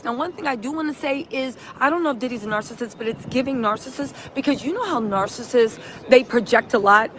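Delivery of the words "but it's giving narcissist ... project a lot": ordinary delivery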